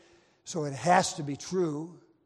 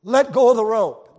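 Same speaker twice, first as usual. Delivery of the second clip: commanding shout; 3 semitones higher, 11 dB louder than usual. Acted. Captain ordering sailor.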